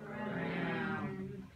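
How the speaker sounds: "Cram" low, soft and held long; held sound. Shown as a demonstration. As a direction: hold "Cram" down before adding p. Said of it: "hold down the cram".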